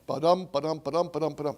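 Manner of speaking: da-DUM rhythm sung out; chanted beat. Shown as a demonstration. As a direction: tap the meter with your voice. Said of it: "That's iambic pentameter".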